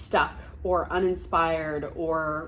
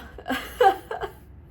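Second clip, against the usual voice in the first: teary high laugh; 13 semitones higher, 5 dB louder than usual. Real, not acted. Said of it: "getting so emotional".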